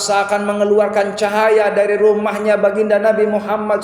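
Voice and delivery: high, loud sermon storytelling; impassioned preaching. Real, not acted. Preaches at full force.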